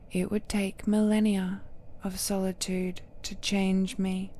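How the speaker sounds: usual speaking voice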